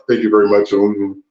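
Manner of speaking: very deep, put-on stage voice; imitated singer, exaggeratedly low. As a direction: drop way down for a mock showman's thanks. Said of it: "he got out there like".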